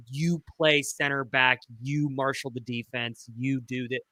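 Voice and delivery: ordinary talk, nothing marked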